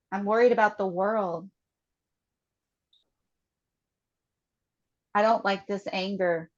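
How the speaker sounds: slow statements with long pauses between, for the group to repeat; guided tapping statements. Acted. Facilitator leading the group through tapping, slow and measured. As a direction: lead each feeling out calmly, one by one.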